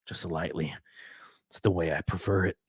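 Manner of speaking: soft breathy whisper, lips close to the mic; teasing whisper. Pretend. Streamer whispers a teasing aside to chat, hushed. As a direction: whisper it like a playful tease to chat.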